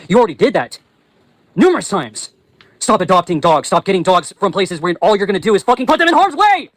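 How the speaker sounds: real anger boiling over; angry yelling. Real, not acted. Vlogger demanding they stop adopting dogs, angry. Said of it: "I get really fucking angry".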